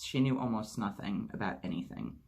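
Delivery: plain reading voice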